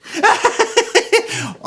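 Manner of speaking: high, loud mimicked laugh; imitated giggle. Wife laughing off in the corner, imitated giggle. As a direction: burst into a high cackling titter. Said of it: "Irene Dunne off in the corner going".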